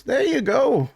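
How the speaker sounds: pleased with his work, hand it over; cheerful shopkeeper. Acted. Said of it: "He goes".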